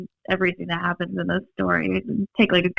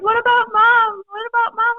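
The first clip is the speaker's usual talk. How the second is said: put-on much higher small child voice; worried young boys' voice, pitched way up; little kids asking; 3 dB louder than usual. Little brothers asking for their mother, high child voice. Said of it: "They're like".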